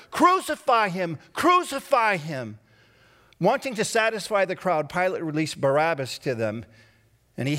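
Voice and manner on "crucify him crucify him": insistent, raised mob chant; louder repeated mob cry; shouting louder. Acted. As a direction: hammer it like an angry crowd. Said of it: "But they shouted even louder".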